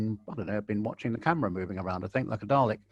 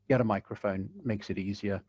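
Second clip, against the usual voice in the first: ordinary delivery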